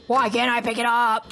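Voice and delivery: groaning undead voice; zombie impression. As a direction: moan it like a zombie. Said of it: "my very good, um, zombie impression".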